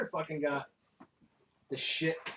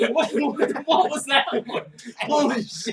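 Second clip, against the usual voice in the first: laughing; 6 semitones higher, 9 dB louder than usual. Real, not acted. Laughing reaction to the voice.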